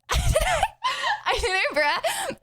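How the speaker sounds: high squealing laugh, very slow. Real, not acted. Asked about her sofa, streamer bursts out laughing high. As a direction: crack up completely before the words.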